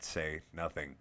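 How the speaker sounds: ordinary delivery, nothing marked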